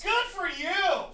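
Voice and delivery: high, drawn-out congratulation; mock cheer. Real, not acted. Heckling the storyteller, drawn-out mock cheer. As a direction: stretch it out high in mock praise.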